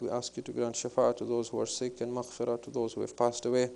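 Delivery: soft, quick murmured supplication; quiet closing prayer. Acted. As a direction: pray it low and hurried to the gathering.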